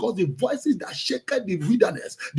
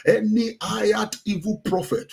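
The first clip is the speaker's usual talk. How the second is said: ordinary delivery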